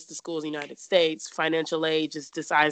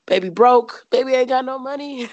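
higher, louder, playful sass; sassy broke joke; 8 semitones higher, 7 dB louder than usual. Retold or imitated. Telling the interviewer she had no money, sassy and playful. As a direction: brag about being broke with a wink. Joking about having no money.

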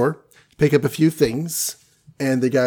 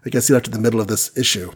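usual speaking voice

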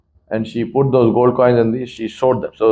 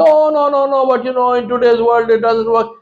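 high, whiny dismissive objection; mock excuse; 10 semitones higher than usual. Preacher mocks people who say truth fails. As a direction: mimic the doubter brushing truth aside. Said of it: "We say".

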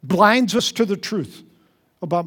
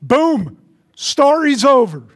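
higher, louder punchy announcement; Jesus's punchline; 5 semitones higher, 5 dB louder, slower than usual. Retold or imitated. Jesus ending the parable, punchy and louder. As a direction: slam the story shut. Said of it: "and Jesus goes".

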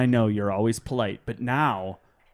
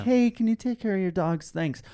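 mock-polite, lifted high voice; high-pitched, overly polite request; super polite; 6 semitones higher than usual. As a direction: ask sweetly in a higher voice, putting on manners. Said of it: "are super polite all the time".

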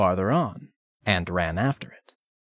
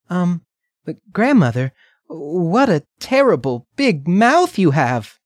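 put-on little girl voice; 8 semitones higher, 5 dB louder than usual. Redcap's last frightened question.

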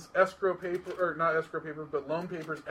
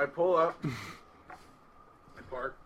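ordinary delivery

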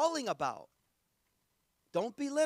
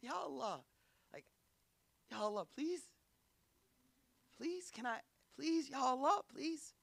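soft, hushed, timid begging; shy plea; 2 semitones higher, 7 dB quieter than usual. Retold or imitated. Old Zakaria shyly begging his Lord for a child. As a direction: ask like an old man too shy to ask. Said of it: "he asks in a shy".

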